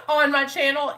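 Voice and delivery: high burst of laughter into the words; real laugh. Real, not acted. Joking about all the channel attention, laughing. Laughing at her sudden fame.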